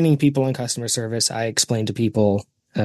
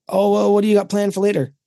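high-pitched cashier small talk; chatty cashier; 8 semitones higher than usual. Retold or imitated. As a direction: make over-friendly checkout chit-chat.

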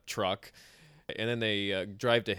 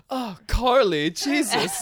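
much higher and louder, hammed-up disgust; mock disgusted cry; 12 semitones higher, 7 dB louder, slower than usual. Teasing about Carly peeing in the woods, a mock shriek. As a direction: yelp at her in mock shock. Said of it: "prime opportunity for".

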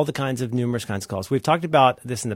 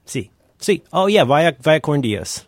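playful put-on Spanish, louder; mock Spanish accent; 3 semitones higher, 5 dB louder than usual. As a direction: ham up a Spanish phrase.

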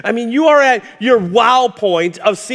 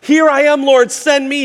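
higher, louder, eager burst; excited; 6 semitones higher, 3 dB louder than usual. Retold or imitated. Isaiah eagerly volunteering to the Lord, excited and loud. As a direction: blurt it out eagerly. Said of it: "he's all excited".

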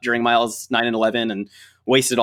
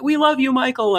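retold fan shout; 13 semitones higher than usual. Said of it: "they'll be like".

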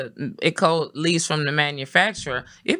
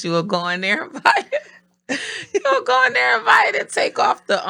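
laughing, pitch jumping high and loud; 8 semitones higher, 5 dB louder than usual. Real, not acted. Confessing a cheap gift trick, laughing hard. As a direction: crack up while getting the words out.

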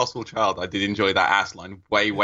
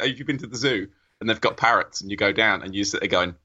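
ordinary delivery

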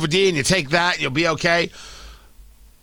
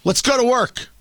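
normal talking voice

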